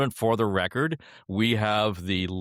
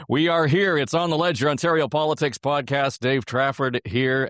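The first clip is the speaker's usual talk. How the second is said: higher, louder show-opening announcer voice; podcast host intro; 6 semitones higher, 4 dB louder than usual. Acted. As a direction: kick off the show with host energy.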